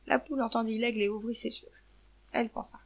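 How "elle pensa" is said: usual speaking voice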